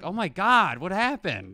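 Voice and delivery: fake surprise. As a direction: play innocent, surprised. Dad fakes surprise at damage.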